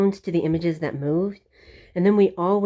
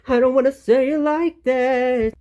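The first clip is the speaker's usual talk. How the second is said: higher and louder, hammed-up retake; overdone retake; 7 semitones higher, 4 dB louder than usual. Shown as a demonstration. Vlogger trying a dramatic take before calling it cringy, hammed-up loud. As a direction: throw the line out big and theatrical. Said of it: "like, super cringy".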